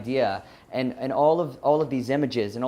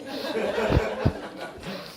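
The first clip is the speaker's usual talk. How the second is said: breathy laugh; 9 semitones higher, 4 dB quieter than usual. Real, not acted. Laughing at shocking confession.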